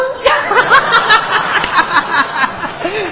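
念错了自己忍不住笑; 尖声笑场; 憋不住笑出声. Real, not acted. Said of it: "还有脸乐呢". (念错后忍不住笑场)

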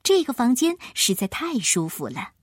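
ordinary delivery, nothing marked

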